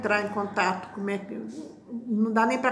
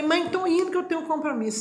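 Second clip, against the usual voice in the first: hurried goodbye; 6 semitones higher, faster than usual. Retold or imitated.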